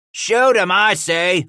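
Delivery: high, loud order to fire; cried out. Acted. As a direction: shout the order across the wall. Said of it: "a voice cried out".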